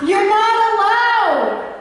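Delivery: high, slow indignant shout. Acted. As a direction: bark the ban out loud. Bear barring toy from camp.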